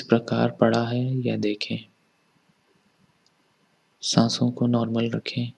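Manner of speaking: calm, even body-scan narration with long pauses; guided meditation. Acted. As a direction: guide listeners gently inward, leaving space after each instruction.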